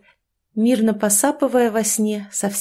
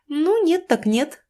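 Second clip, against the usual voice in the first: smiling shrug; 9 semitones higher, slower than usual. A narrator's reading. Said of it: "улыбнулась Катя".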